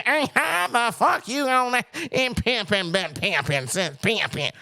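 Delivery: high, hammed-up pimp stage voice; Cat Williams. Host contrasts the comedian on stage, exaggerated pimp voice. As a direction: imitate his over-the-top stage act, starting high. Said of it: "But when he on stage".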